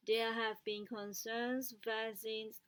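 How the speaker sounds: usual speaking voice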